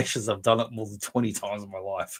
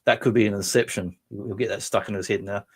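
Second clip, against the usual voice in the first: ordinary talk, nothing marked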